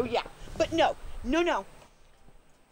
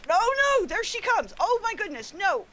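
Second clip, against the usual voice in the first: alarmed; 7 semitones higher, 4 dB louder than usual. Real, not acted. Bear returns.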